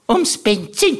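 put-on high, regretful old aunt, laughing; Tante Mari. Aunt lamenting wasted petrol, high and amused. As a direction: sigh it out as the aunt. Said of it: "weil sie es aber allen noch einmal gesagt hat".

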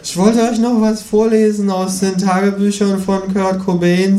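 high-pitched kangaroo voice; eager kangaroo. A narrator's reading. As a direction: announce it in a high character voice.

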